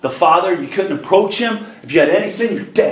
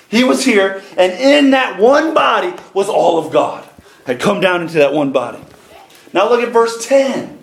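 usual speaking voice

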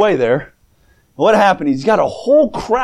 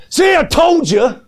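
playful gloat; 11 semitones higher, slower than usual. Pretend. Jesus to the mocking crowd, hammed-up gloating shout. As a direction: rub it in with a grin.

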